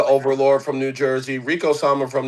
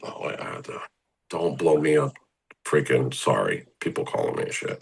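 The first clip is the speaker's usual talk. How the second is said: soft whispered aside to himself; hushed, annoyed aside about the calls; muttered aside; 10 semitones lower, 7 dB quieter than usual. Real, not acted. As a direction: mutter it under your breath, distracted by the phone. Phone buzzing mid-interview aside.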